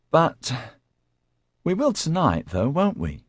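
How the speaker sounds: high, pleading whiny voice. Acted. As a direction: whine it like a needy man. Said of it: "he asked in a whiny voice".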